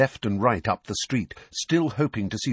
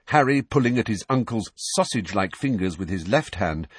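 ordinary delivery, nothing marked

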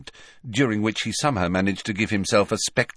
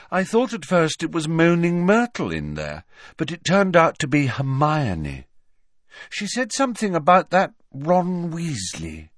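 put-on high-pitched, unhurried girl's voice; candid, vague Luna; 7 semitones higher than usual. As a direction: report it artlessly in a raised pitch.

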